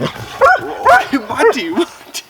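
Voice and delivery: high, loud calls of Monty; high shout. Real, not acted. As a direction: shout the dog's name in alarm. Dog going wild.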